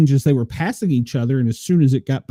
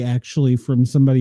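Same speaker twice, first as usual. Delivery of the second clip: usual speaking voice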